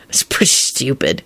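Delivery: subdued, awkward admission. A narrator's reading. Lupin apologising for taking things, awkwardly. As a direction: blurt it with understated embarrassment. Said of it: "he blurted out awkwardly".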